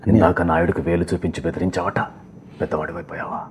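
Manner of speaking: low, measured threat in a film elder's voice; Nassar impression. Film elder threatening a boy over wagging a finger, low stern voice. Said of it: "Nazar గారికి చెప్పిన".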